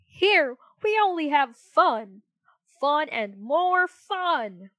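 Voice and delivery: high, loud, slow sing-song cry; excited cry. Acted. Jack in the Box luring Pinocchio, excited cry. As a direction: bounce it out like a springing toy. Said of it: "cried a Jack in the Box".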